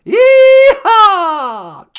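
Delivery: loud high cowboy whoop; cowboy yeehaw. Acted. Bush signing off to Natasha, loud yeehaw. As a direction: belt it like a rodeo cowboy. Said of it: "you can call me Georgie".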